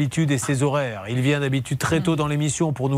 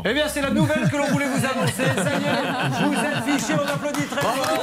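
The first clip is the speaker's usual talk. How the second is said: high, laughing mock fanfare announcement; surprise party shout; 9 semitones higher than usual. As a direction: announce bad news like a party prize. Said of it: "On voulait lui faire la surprise".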